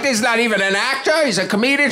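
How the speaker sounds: high-pitched, hammed-up diva outrage; indignant old actress. Older actress objecting to a comedian co-star, indignant. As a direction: protest as a scandalized older actress. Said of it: "This lady just goes off".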